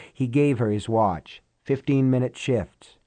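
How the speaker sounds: ordinary delivery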